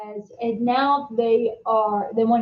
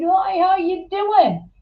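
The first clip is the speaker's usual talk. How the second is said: bright friendly greeting; 8 semitones higher, 4 dB louder than usual. Retold or imitated. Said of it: "I said".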